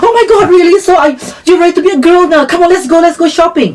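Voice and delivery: high, fast, gushing; excited friend. Retold or imitated. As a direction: rush her off to the shops. Friend excitedly urges shopping.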